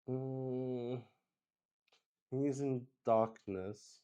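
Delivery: ordinary delivery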